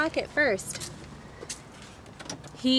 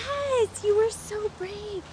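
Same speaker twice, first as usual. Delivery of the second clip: sing-song praise; 11 semitones higher, slower than usual. Real, not acted. Mom praising child after dentist.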